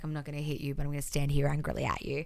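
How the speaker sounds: low, soft imitation of a man holding back; imitated restrained refusal. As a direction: drop it down, quietly standing firm. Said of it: "Seth's like".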